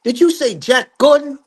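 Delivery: high-pitched challenge; mean, incredulous demand. Retold or imitated. Joe Jackson challenging the father over Jack Gordon, mean. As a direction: imitate a man pouncing on a name. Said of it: "he got a little mean demeanor to him".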